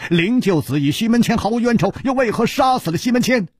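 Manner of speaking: high, fast, pressing question; urgent questioning. Acted. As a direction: puzzle it out with rising urgency. Hero's urgent puzzled question. (又高又快地急切追问)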